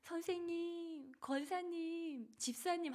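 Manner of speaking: put-on high, stretched child's call. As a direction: call out like a kid running over. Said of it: "하고 달려온다고요".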